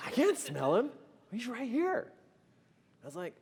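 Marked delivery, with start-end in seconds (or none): 0.1-2.1 high, loud comic protest; laughing, pitched-up indignation; retold disbelief. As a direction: act out a flabbergasted husband.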